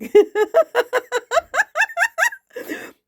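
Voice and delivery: a long, very high, loud burst of laughter; cracking up at her own joke; big laughing fit. Real, not acted.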